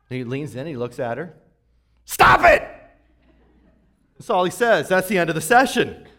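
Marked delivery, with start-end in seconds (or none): 2.0-2.7 high, loud, sudden "Stop it!"; a therapist's blunt shouted order; sharp shout. Retold or imitated. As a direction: bark it at her out of nowhere. Newhart therapist snaps at patient.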